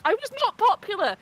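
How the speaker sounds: NOT punched high, indignant protest. Retold or imitated. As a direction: hit the word not in outrage. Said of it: "I was like".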